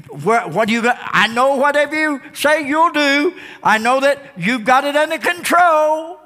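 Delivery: indignant scolding, pitch climbing as it goes; scolding Martha. Acted. Martha berating Jesus for dragging his feet, indignant. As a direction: pile up exasperated reproaches. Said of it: "I can just see her saying to Jesus".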